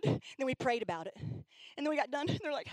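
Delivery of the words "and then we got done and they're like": normal talking voice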